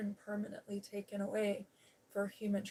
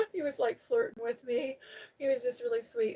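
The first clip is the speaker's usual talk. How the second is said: real amused laughter, voice jumping up; laughing; 3 semitones higher, 5 dB louder than usual. Real, not acted. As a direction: laugh as you tell it, amused by the flirting. Laughing at officer's flirting.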